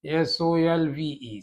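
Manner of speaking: S O L V E spelled letter by letter; एस ओ एल वी ई spelled out slowly; spelling out. Shown as a demonstration. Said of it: "या सॉल्व लिख लीजिए". (एस ओ एल वी ई अक्षर-अक्षर धीरे बोलें)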